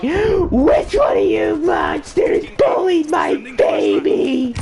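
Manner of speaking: high, slow shrieking angry mom; Karen voice. Retold or imitated. Karen mom confronting the kids, high angry shout. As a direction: play an outraged Karen. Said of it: "literal definition of the word Karen".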